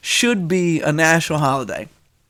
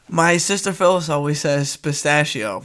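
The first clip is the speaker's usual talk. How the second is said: ordinary talk, nothing marked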